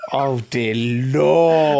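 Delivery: slow, drawn-out groan of dread; mock dismay. Real, not acted. Co-host hearing where the story is going, slow mock dismay. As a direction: drag it out in dread at what's coming.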